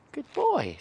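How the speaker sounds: high praise. Real, not acted. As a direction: coo it at the pup. Praising puppy.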